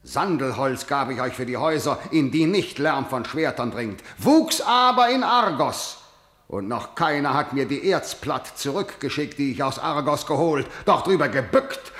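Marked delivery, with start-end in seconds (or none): 4.2-6.0 high, forceful jab; indignant retort. Acted. Kreon reproaching those who took his spoils, indignant. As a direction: throw it out high and hard.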